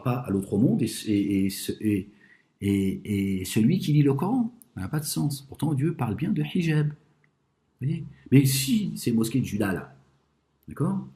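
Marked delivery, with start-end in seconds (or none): none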